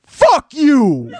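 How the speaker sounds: high, very loud imitated curse; furious yell. Scalper to the man refusing him, furious yell. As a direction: explode after a long silent stare.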